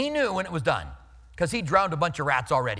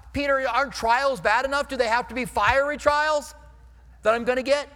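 higher, louder mock complaint to Peter; hammed-up protest to Peter; mock whine; 6 semitones higher, 4 dB louder than usual. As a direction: whine at Peter like a put-upon believer.

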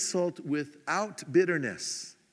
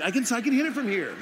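quick, higher-pitched mock plotting; eager scheming; 5 semitones higher, 4 dB louder, faster than usual. Sleepless neighbor eyeing dog from back window, mock-eager scheming. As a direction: rush it out, comically keen.

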